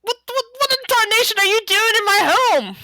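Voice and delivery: high, outraged country shout; indignant hillbilly farmer. Acted. Farmer elf shouts at intruders in his home, outraged.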